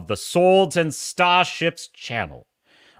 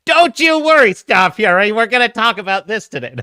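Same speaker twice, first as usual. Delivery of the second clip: loud, high captain's reassurance; rousing promise; 6 semitones higher, 7 dB louder than usual. Pretend. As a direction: belt out the promise to the crew. Mock-rousing repeat to the viewer.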